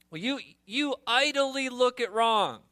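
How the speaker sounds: mock prophet scolding God; accusing prophet. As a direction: throw the blame at God. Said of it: "Does not idle speak to laziness".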